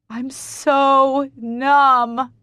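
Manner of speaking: very slow, high, loud wail; drawn-out grieving wail. Acted. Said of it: "I wail".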